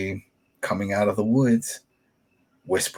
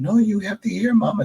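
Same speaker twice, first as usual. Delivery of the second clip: put-on much higher, insistent child; pleading daughter; 13 semitones higher, 3 dB louder than usual. Host imitates Lydia pleading with her mother, high voice. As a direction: beg your mama to listen. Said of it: "she's like".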